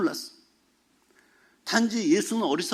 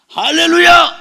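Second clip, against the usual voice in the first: loud, higher cry of hallelujah; bold proclamation; 10 semitones higher, 12 dB louder, slower than usual. Retold or imitated. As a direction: declare 할렐루야 boldly to the whole church. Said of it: "담대하게 선포했더니".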